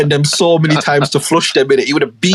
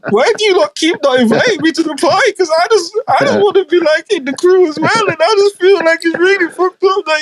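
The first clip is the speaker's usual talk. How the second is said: put-on much higher, wailing drunk self-pity; a hammed-up drunk crying to the party crowd; drunk whiny crying; 13 semitones higher than usual. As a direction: whine it like a weepy drunk begging to be invited. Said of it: "coming to every party drunk and start crying".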